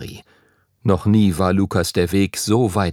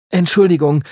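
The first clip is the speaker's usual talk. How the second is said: hasty apology; 7 semitones higher, 5 dB louder than usual. A narrator's reading. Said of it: "sagte Lukas rasch".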